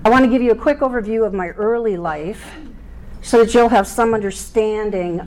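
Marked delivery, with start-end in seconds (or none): none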